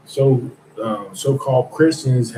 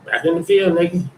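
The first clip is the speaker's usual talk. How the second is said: higher voice, a mocking brush-off; mock Trump dismissal; 6 semitones higher, 3 dB louder than usual. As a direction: toss them back like a scornful boss. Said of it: "That's exactly what he told 'em".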